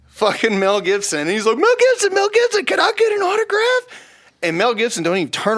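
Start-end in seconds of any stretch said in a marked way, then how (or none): 1.6-4.1 loud, very high starstruck squeal; frantic fan calling out. Retold or imitated. Friend bum rushing Mel Gibson for an autograph, frantic high yelling. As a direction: imitate an overexcited fan chasing a celebrity.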